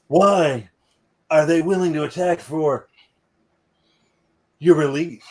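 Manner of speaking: halting, lightly voiced Kirk with dramatic pauses; mock Captain Kirk, pausing between phrases; doing Shatner. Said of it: "I'm trying to do Shatner".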